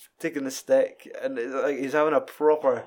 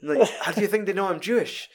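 mock panicked character; 7 semitones higher than usual. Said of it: "we're just like".

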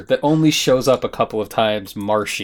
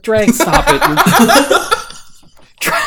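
giggling through a higher voice; laughing; 10 semitones higher, slower than usual. Retold or imitated. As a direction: crack up mid-scold. Laughing while mock-scolding Dre.

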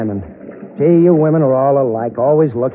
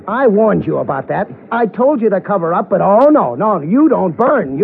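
scolding; 7 semitones higher than usual. Acted. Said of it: "You can shout at me".

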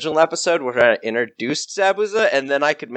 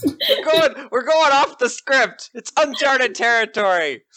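dramatic announcer; 9 semitones higher than usual. Pretend.

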